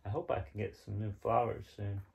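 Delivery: plain reading voice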